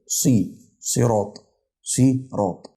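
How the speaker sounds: slow "صراط", heavy s with i, si-raat; "صراط" sounded out in careful pieces; sad with kasra. Shown as a demonstration. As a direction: split "صراط" after a thick "صي". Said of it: "صراط بتتنطق صي".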